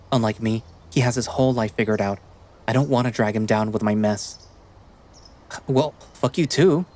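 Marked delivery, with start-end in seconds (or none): 5.7-6.9 천천히 쏘아붙이는 상처받은 말투; hurt retort. Acted. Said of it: "His words stop me in my tracks".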